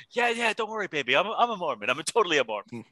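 hammed-up guy reassuring his girlfriend; mock smooth talker. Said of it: "the guy's like".

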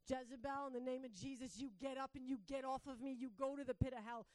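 commanding exorcism prayer, loud and insistent; forceful command. Retold or imitated. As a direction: re-enact ordering a demon out at full force. Acting out casting out a demon.

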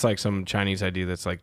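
ordinary talk, nothing marked

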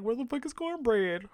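mock-confused girls asking where she went; confused queens. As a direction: play the girls looking around, baffled. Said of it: "everybody's like".

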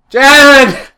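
loud, high holler for a housemate; calling out. Acted. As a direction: yell the name through the house.

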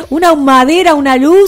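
laughing. Real, not acted. Laughing about the smoky studio.

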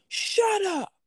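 high mock "shut up" yell; mock snap. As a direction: snap it at them in a high put-on voice. Booth owner snaps at yelling men.

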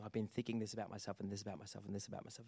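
quick, soft run-on mumble; mock rambling. Client listing worries to the counselor, rambling softly. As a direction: rattle it off softly, low and repetitive.

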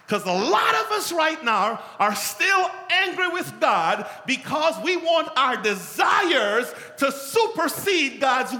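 loud, high, slowed shouting sermon; preaching at full force. Acted. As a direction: drive the point home at full force.

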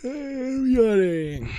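sing-song "Everybody", pulled long and high; high drawn-out call. Real, not acted. Host calls everyone again, drawn out.

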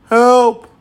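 high, loud call for rescue; mock cry for help. Acting a louder cry for help.

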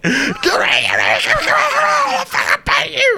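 slow taunt building to high shout; angry dog voice. Pretend. Dog's voice, growing to shout.

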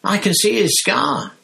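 very high, loud, fast babble; thrilled high-pitched chatter; gleeful excitement. Acted. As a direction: gush it all out to Ern. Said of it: "Stan shouted gleefully".

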